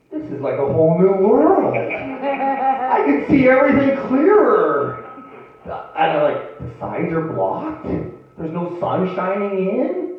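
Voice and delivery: high-pitched, wide-eyed comic wonder; excited. Retold or imitated. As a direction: marvel loudly at a brand-new discovery. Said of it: "I was excited".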